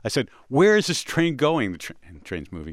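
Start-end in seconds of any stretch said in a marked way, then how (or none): 0.5-1.7 loud, higher, slower question; urgent call. Retold or imitated.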